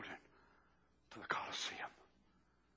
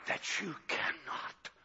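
sudden hush; 7 semitones higher, 6 dB quieter, slower than usual. Acted. Drop to hush after shouting.

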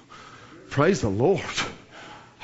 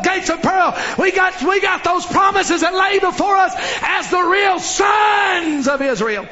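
full-force preaching; 6 semitones higher than usual. Acted. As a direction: build it like a revival peak, voice ringing.

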